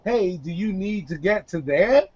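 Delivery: ordinary talk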